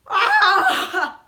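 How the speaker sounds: very loud, high fake wail; hammed-up bawling cry; demonstrated wailing. Shown as a demonstration. Showing her crying level for Falcon, loud wailing. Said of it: "my crying level. I'll show you".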